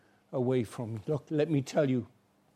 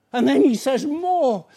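preaching emphasis; 8 semitones higher, 6 dB louder than usual. Acted. Preacher repeats line with force.

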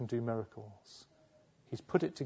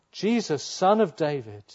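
shout; 3 semitones higher, 6 dB louder than usual. Retold or imitated. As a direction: call out to be heard over the crowd. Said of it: "And so he shouts out".

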